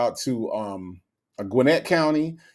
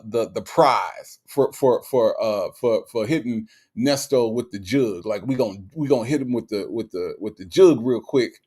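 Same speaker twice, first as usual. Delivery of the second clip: ordinary talk, nothing marked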